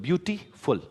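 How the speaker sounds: Beautiful broken as "beauty... फुल"; pause mid-word. Shown as a demonstration. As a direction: stop briefly before the last syllable of beautiful. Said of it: "जैसे बीच में रुक रहे हो".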